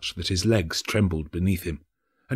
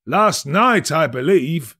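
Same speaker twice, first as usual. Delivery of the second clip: higher, louder merchant answer; offhand merchant reply; 8 semitones higher, 6 dB louder, slower than usual. A narrator's reading.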